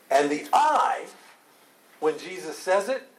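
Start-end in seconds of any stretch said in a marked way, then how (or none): 0.5-1.1 "I" much higher and louder; emphatic I. Shown as a demonstration. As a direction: punch "I" high and strong. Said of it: "Strongly emphasize".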